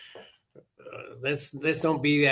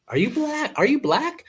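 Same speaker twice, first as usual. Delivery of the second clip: much higher, put-on voice, pressing the question; mimicking a nosy man quizzing him about his race; incredulous stranger; 13 semitones higher than usual. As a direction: squeak it out like a baffled stranger. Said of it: "He goes".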